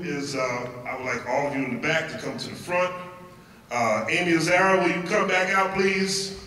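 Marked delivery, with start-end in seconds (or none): none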